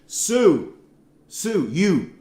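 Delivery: high, swooping mock call to a wife; play-acted calling, pitch arching up; calling his wife. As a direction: draw out the name as if calling her. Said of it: "my wife's name is Susan, so I could say".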